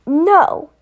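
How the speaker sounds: loud, rising no; a desperate loud cry; anguished shout. Acted. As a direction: yell out to stop it. Cry as friend is attacked.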